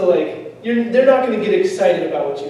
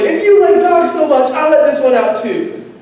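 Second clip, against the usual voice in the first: upset lady; 8 semitones higher, 4 dB louder than usual. Retold or imitated. Upset lady threatening with dog.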